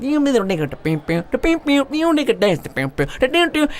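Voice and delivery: high twangy vocal guitar noodling; imitated guitar riff. Imitating the bar musician's cool guitar stuff, vocal riff. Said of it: "he's playing some cool guitar stuff".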